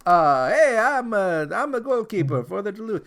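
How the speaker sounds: high, loud hammed-up Italian accent; exaggerated sing-song Italian accent; mock Italian accent. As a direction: play a cartoon Italian goalkeeper. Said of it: "that's the Italian".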